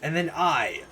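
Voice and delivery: low grandiose villain monologue; low gloating villain. Acted. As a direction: savor every word of world domination.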